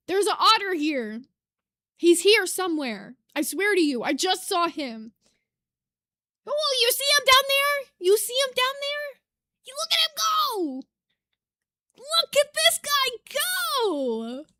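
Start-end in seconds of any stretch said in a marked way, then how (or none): 6.5-14.5 high, thrilled squealing voice; pitched way up with delight; real excitement. Real, not acted. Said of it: "Sorry, that got me really excited".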